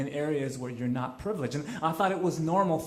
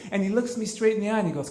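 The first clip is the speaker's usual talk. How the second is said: ordinary delivery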